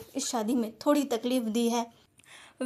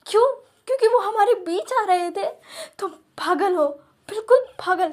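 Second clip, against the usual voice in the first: furious shouting; 3 semitones higher, 4 dB louder than usual. Acted. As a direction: scream at him through tears. Wing shouts at his husband. (रोते हुए गुस्से भरी चीख)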